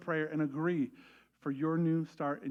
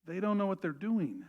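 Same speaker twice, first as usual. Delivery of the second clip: tender plea. Retold or imitated. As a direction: pray it gently. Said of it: "He said".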